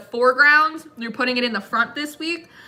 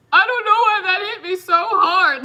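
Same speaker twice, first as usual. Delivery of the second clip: mock emotional; 8 semitones higher, 5 dB louder than usual. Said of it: "and I was like".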